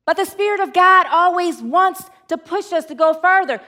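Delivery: usual speaking voice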